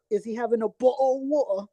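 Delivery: put-on British accent with dropped t's; mock British accent. Teasing a viewer from the UK, mock British accent. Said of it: "from the U K".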